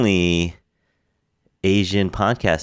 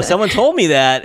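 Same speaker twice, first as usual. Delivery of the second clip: usual speaking voice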